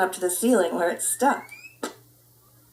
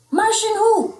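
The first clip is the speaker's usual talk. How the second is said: much higher, louder curious ask; knock-knock reply; 12 semitones higher, 6 dB louder than usual. Acted. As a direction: play along with the joke. Skipito knock-knock, high.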